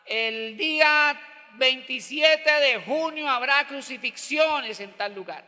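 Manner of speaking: slow, drawn-out public announcement; official proclamation. Acted. Empire informing crowds of a crucifixion, proclaimed slowly. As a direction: proclaim it like an imperial notice, falling away at the end. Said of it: "cuando el imperio informaba".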